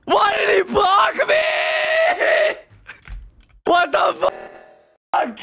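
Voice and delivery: high-pitched, furious wail of complaint; real high, drawn-out protest; outraged yelling. Real, not acted. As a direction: yell it in aggrieved disbelief. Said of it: "Why are you yelling?".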